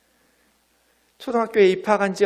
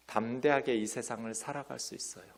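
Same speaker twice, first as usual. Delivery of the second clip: normal talking voice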